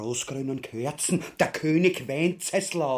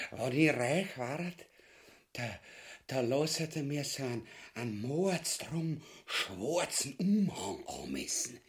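dreamy musing; 4 semitones lower, 6 dB quieter than usual. Acted. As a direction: drift off into a greedy dream. Ubu daydreams of riches.

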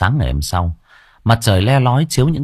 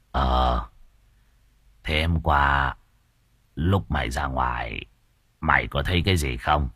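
put-on low old master; 8 semitones lower, 7 dB quieter than usual. Master questions servant.

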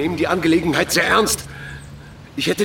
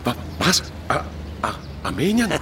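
frightened stammer; 4 dB quieter than usual. Acted. Police chief stammering in fear at the word Armenia. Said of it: "zittert und macht sich in die Hose".

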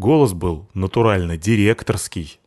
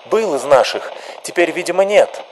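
luid, hoog en opgezweept; fiery activist; 8 semitones higher than usual. Acted. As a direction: hits de menigte op.

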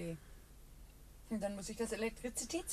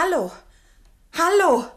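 loud, high calls into a dead phone; calling out; 7 semitones higher, 15 dB louder than usual. Acted. Daughter calling into the dead telephone, loud and urgent. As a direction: shout hello down the line, getting no answer.

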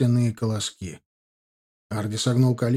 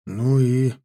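displeased grumble; 4 dB louder than usual. Acted. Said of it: "В том же тоне столь же недовольно повторил".

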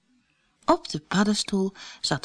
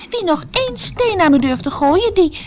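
loud, high angry warning; angry threat; 12 semitones higher, 5 dB louder than usual. A narrator's reading. Alice pelted with stones, loud angry warning. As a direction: threaten them to stop. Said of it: "Alice riep boos".

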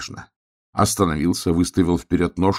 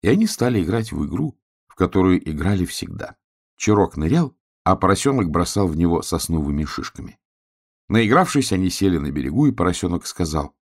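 ordinary delivery

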